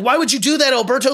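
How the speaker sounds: retold quarrel. Luca fighting with Alberto after the crash, retold protest. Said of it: "they're fighting".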